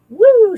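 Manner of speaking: high, loud rising whoosh sound; drawn-out high "woo". Shown as a demonstration. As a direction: imitate something shooting out with a "woo". Imitates energy shooting upward.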